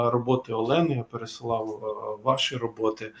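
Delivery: ordinary talk, nothing marked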